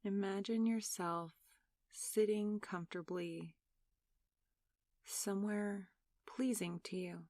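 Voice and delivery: soft, unhurried meditation voice with long pauses; guided meditation. Real, not acted. Meditation leader asking listeners to close their eyes and breathe, soft guided meditation.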